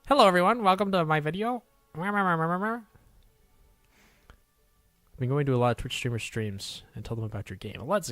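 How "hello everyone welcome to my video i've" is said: high, loud clickbait intro; hammed-up cheery YouTuber greeting. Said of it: "The YouTuber voice is so funny".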